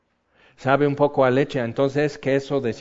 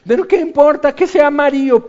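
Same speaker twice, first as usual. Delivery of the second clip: much higher and louder, fed up; exasperated outburst; 12 semitones higher, 9 dB louder than usual. Retold or imitated. Preacher mocking the dress debate, loud exasperation. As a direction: throw up your hands over the dress.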